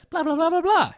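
high-pitched put-on character voice; mock actor's line. Reviewer mimicking an actor cutting in before "he said", high put-on voice. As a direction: pipe it up high, mimicking a cast member. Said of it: "we switch to an actor who says their lines".

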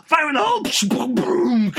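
high shouted warning, then explosion noise; hammed-up action-movie yell; mock battle cry. Hero warning before a pretend blast, high shout.

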